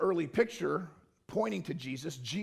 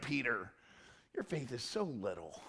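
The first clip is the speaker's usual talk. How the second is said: lower, softer disappointed tone; mild rebuke; 6 semitones lower, 9 dB quieter than usual. Retold or imitated. God softly tells Peter his faith is small, low and quiet. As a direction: let him down gently, quietly. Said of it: "He's like".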